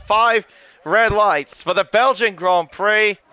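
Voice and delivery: lively play-by-play race call; motorsport commentator. Acted. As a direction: call the race start as it happens.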